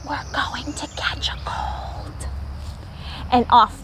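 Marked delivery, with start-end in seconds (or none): 0.1-2.3 whisper. A narrator's reading. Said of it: "He whispered softly".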